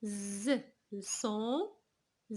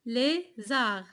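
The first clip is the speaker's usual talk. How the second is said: "Lézard" split as LÉ, pause, ZARD; syllable by syllable. Shown as a demonstration. Teacher counting syllables for pupils, "Lézard" chopped in two. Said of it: "Début, la fin?".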